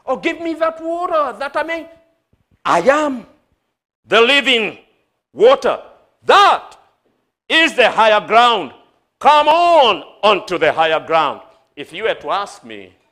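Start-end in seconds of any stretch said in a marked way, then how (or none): 0.0-1.9 high, rushed woman's voice; acted excited plea in a high voice; eager pleading. 2.7-11.4 loud, emphatic proclamation in separated bursts; ringing, forceful sermon climax; full-force preaching. Acted.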